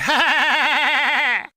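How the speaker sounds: loud, high forced Cockney laugh; the landlady's forced laugh, loud and high; forced embarrassed laugh. A narrator's reading. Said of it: "forced Cockney laugh of embarrassment".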